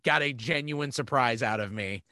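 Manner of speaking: ordinary delivery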